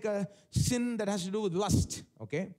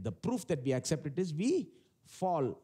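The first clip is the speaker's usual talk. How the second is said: ordinary delivery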